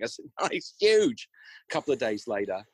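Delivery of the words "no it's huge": high, loud insistence, retold; insistent. Husband insisting to his doubting wife the rat is huge, high and loud. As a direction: push back hard, he's not exaggerating.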